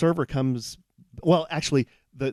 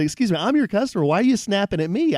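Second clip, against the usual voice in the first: raised, higher, louder protest; man retelling how he scolded a curt server, indignant and raised; indignant retort; 9 semitones higher, 5 dB louder, faster than usual. Retold or imitated. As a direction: push back hard at the rude waitress. Repeating his retort to server.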